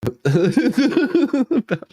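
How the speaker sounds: long high laugh; can't stop laughing; cracking up at the headline; helpless laughter. Real, not acted.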